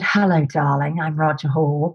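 a hint of a beautifully spoken charmer; posh charmer. Roger greeting the newcomer, lightly voiced smooth charmer. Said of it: "he was so beautifully spoken".

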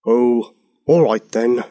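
slow, grudging consent; cross. A narrator's reading. King gives in about lighting the fires, crossly. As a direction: give in crossly, drawn out. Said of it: "said the King crossly".

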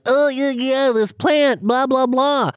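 high, fast shady stranger; sketchy guy voice. Retold or imitated. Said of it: "some guy was just like".

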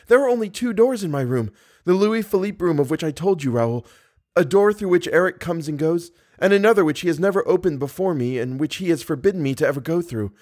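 put-on Christine, captive young woman. A narrator's reading. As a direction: explain urgently in a high female character voice.

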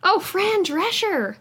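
high, slow drawn-out name; excited recall. Real, not acted. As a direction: savour the name as it comes back.

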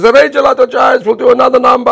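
put-on high, loud showman announcement in an Italian accent; TV host announcing. Host announcing the band, loud showman call. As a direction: boom it out like a game-show host. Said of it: "And he says".

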